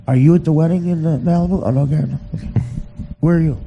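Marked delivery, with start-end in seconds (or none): none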